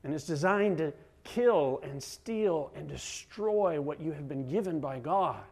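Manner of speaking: usual speaking voice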